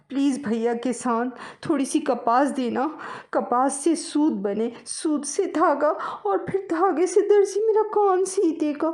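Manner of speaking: high, loud pleading mouse; little mouse begging for cotton. Acted. Mouse begs farmer.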